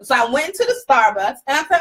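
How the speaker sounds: usual speaking voice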